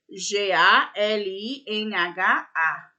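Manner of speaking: very slow, letter by letter, then the word drawn out; spelling out. Shown as a demonstration. Teacher spells a word for pupils to copy, very slowly. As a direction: dictate it slowly for young pupils to write.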